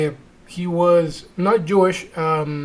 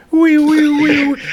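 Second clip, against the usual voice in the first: high vocal imitation of eerie music; wibbly wobbly music; 8 semitones higher than usual. Mocking the priest's promise, high wobbly music noises. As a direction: wail high, repeated mystical sound-effect notes. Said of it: "cueing the wibbly wobbly music".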